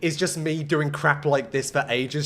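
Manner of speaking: ordinary talk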